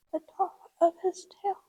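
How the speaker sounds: whispering to herself. Real, not acted. Braiding the tail, she whispers the steps to herself. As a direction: murmur the steps under your breath as you work.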